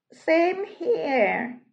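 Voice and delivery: high, purring cat voice; meowing cat. Acted. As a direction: meow the line lazily. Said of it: "the cat meowed".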